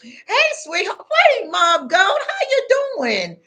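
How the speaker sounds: high, syrupy mock-friendly hello; sugary taunting high voice; fake sweet greeting. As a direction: sing it out sweetly like a smug tormentor pretending to be nice. Mocking a smirking tormentor.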